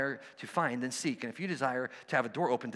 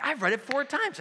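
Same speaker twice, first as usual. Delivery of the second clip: pitched way up and louder, fed up; exasperated; 12 semitones higher, 4 dB louder than usual. Retold or imitated. Friend venting to the pastor, high exasperated voice. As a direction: blurt it out like a frustrated friend. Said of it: "you could just sense his palpable frustration".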